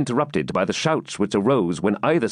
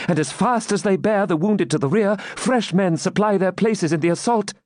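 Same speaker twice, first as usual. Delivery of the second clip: high-pitched, urgent eyewitness report; excited maiden; 10 semitones higher than usual. Acted. Maiden at lattice reports battle to knight, agitated. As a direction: call the battle high and fervently.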